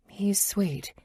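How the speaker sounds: whispered He's sweet, breathy and secret. A narrator's reading. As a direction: whisper it to the girls. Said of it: "I say to the girls".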